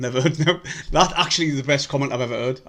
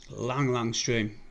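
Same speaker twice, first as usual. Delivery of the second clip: weary drawl; 3 semitones lower, 5 dB quieter, much slower than usual. Real, not acted. Fan repeating with weary drag.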